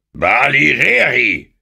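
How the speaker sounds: laut, hoch und gedehnt zornig; zornerfüllter Schrei. A narrator's reading. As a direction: schrei es voller Zorn. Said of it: "schrie der König zornerfüllt". (wütend brüllender König)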